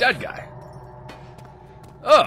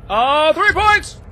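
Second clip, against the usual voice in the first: high, loud victory yells; gleeful high shouting; triumphant shouting; 11 dB louder, slower than usual. Real, not acted. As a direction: crow over the hit like a boss. Celebrating a hit.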